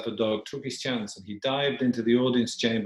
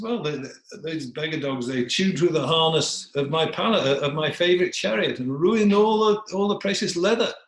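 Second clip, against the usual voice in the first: high, rising royal complaint; indignant king; 7 semitones higher, 5 dB louder than usual. Acted. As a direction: build from grumbling to loud, high-pitched outrage. King complains of chewed harness.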